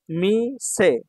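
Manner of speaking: মিসে slow, both syllables held apart. Shown as a demonstration. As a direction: draw out মিসে in two long beats. Teacher sounds out new word.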